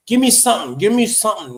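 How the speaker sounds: playful insistent plea; mock pleading. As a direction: coax a smile out of her, half-joking. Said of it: "I'm just like".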